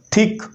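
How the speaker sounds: थिक with a voiceless dental TH, like Urdu थे; hard TH sound. Shown as a demonstration. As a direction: demonstrate the hard TH at the start of थिक. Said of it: "Urdu में जैसे देखिए थे की आवाज़".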